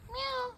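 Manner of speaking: high mock cat meow. Meowing back at her cat, high.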